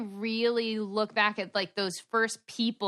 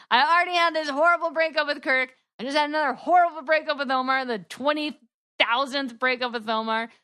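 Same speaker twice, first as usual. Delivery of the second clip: higher, louder exasperated rant about breakups; 4 semitones higher, 4 dB louder than usual. Retold or imitated. To the hosts, retelling her fed-up refusal, higher and louder. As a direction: throw up your hands, fed up with love.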